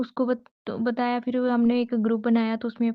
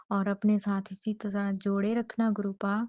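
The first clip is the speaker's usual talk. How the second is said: ordinary delivery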